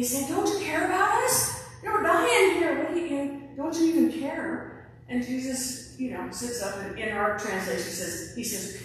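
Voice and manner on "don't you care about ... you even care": high, loud frantic cry over the storm; retold desperate shout; panicked plea. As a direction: yell it like men about to drown. Terrified disciples shouting in storm.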